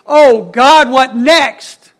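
acted-out plea from someone in agony; agonized cry. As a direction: cry it out as if at wit's end. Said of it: "he was in such agony".

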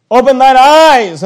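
loud, drawn-out scriptural command; ringing pulpit exclamation; preacher's cry. Acted. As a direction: call it out slowly to wake the congregation. Sudden loud scripture exclamation in sermon.

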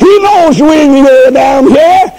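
high, ringing sermon cry; fervent preacher lifting the church; preaching at full force. Acted. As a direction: preach it out to the congregation. Preacher closes with proclamation.

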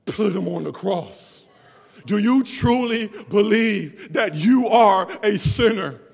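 hammering sinners with questions from the pulpit; preaching. Acted. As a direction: drive each line home to the congregation. Preaching at full force.

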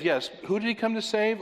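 ordinary talk, nothing marked

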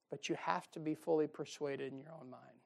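normal talking voice

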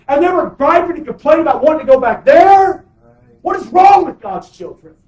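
loud, high, impassioned sermon; fiery preaching. Acted. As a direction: preach it at full force. Preacher rises to full force.

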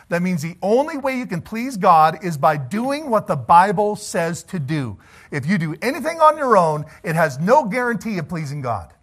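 full-force preaching. Acted. Preacher warns the congregation about pleasing God, fervent. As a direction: preach it hard, rising and pressing.

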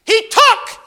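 "took" hit high and loud; stress on took. Acted. Preacher stressing what David took, high and loud. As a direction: punch out "took". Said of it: "emphasis on took".

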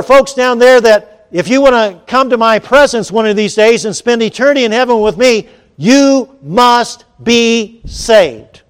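emphatic proclamation. Acted. Preacher quoting God's demand to the congregation, emphatic proclamation. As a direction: proclaim it forcefully, slowing to a word-by-word close.

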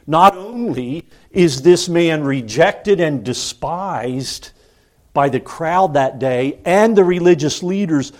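ordinary delivery

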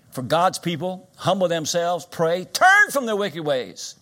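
loud, high pulpit exhortation; urgent preaching. Real, not acted. Preacher urges God's people to repent, exhorting at full voice. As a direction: build to a drawn-out shout.

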